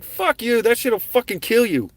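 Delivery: shouting it off in alarm; alarmed outburst. Retold or imitated. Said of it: "I was like".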